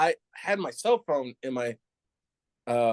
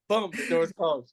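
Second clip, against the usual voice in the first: ordinary talk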